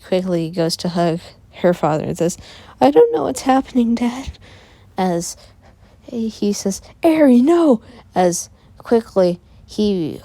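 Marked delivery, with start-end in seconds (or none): 2.8-4.4 put-on high, quick, raised child voice; panicked child. Eri tells Dad she doesn't understand, high and panicked. Frightened child appeals to father. 7.0-7.8 high, loud warning shout; alarmed cry. Acted. Father warns Eri off as she hugs him, loud and alarmed. Father cries out before dying.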